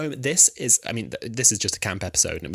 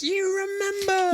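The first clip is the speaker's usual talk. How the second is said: very high, drawn-out delight; delighted reaction; slower than usual. Retold or imitated. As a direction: squeal it slowly with mock amazement. Delighted he remembered the episode.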